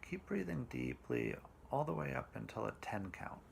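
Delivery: slow, calm meditation voice with long pauses; guided meditation. Acted.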